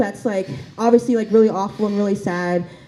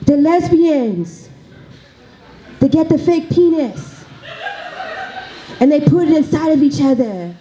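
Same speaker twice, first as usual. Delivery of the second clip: put-on Haitian accent; 5 semitones higher than usual. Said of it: "do the accent again".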